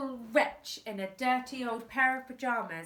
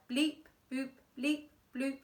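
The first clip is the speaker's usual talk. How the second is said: keypad beeps; 4 semitones higher than usual. Acted. Safe keypad noises.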